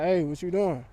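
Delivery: low, soft and sultry; seductive low greeting. Shown as a demonstration. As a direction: draw her in, low and intimate. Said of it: "you gotta start off like this".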